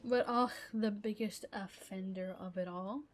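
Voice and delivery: usual speaking voice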